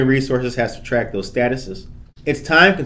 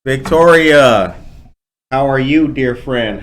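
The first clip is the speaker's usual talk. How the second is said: calling out; 6 dB louder, slower than usual. Real, not acted. Host calls out to online guest.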